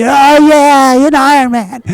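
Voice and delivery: high, loud announcer call with a laugh; excited call to the finisher. Retold or imitated. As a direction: whoop it out over the cheering crowd. Crowd goes crazy, excited call.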